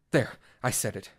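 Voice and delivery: ordinary delivery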